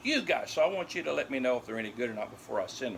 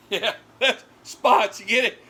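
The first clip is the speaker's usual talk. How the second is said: excited explaining; 7 semitones higher, 8 dB louder than usual. Real, not acted. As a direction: push the pun on the dogs. Explaining pun loudly, laughing.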